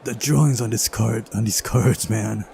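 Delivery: normal talking voice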